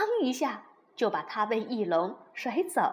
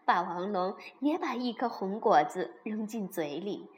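ordinary delivery, nothing marked

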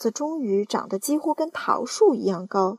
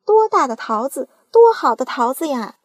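loud, high delighted shout; aunt marvelling at the peach; excited shout; 4 semitones higher, 11 dB louder than usual. Acted. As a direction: cry it out in glee. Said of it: "喊道".

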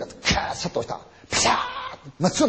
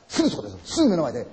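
normal talking voice

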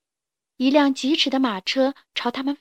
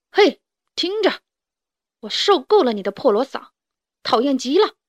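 mildly raised, fed-up voice; annoyed shouting; 2 semitones higher, 4 dB louder than usual. A narrator's reading. Chubby chick yelling at the noisy rooster, mild annoyed shouting. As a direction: call out sharply, then complain. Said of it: "小胖墩冲着卡梅利多喊道".

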